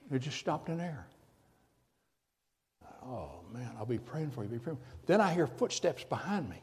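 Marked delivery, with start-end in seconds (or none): 3.0-4.8 very soft, low murmured prayer line; hushed teasing aside. Acted. Preacher teasing doubting Christians in a hushed murmur. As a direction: drop almost to a murmur, teasing. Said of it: "put your faith hat on".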